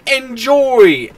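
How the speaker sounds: high, drawn-out mock cheer; taunting. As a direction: flaunt it mockingly. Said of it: "flaunting its flaws in my face and going".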